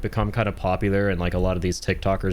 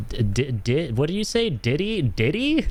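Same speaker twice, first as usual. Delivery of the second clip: baffled disbelief; 7 semitones higher than usual. Retold or imitated. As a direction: stammer into high-pitched questioning. Acted confused friend mishearing name.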